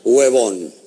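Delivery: low, slow voice from above; solemn heavenly voice, deadpan punchline; voice of God. Acted. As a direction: drop it deep and draw it out. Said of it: "una voz que le dijo".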